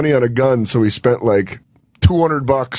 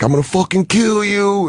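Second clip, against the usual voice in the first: threatening gangster; 9 semitones higher than usual. Retold or imitated. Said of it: "kept tapping my skull, being like".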